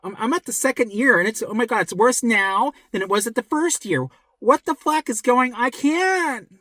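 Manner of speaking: high-pitched, overwhelmed imitation; put-on distressed wail, climbing at the end; mock despair. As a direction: act out someone at the end of their rope. Acting distressed sufferer in second year.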